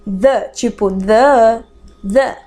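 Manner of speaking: slow "the" with the tongue between the teeth. Shown as a demonstration. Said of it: "Coloca a língua no meio dos dentes". ("the" lento com a língua entre os dentes)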